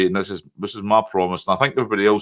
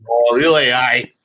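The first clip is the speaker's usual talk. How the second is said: teasing Scottish "aye", a bit louder; Scottish accent; 3 semitones higher, 5 dB louder, slower than usual. Retold or imitated. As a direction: throw back a Scottish accent. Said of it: "your Scottish accent".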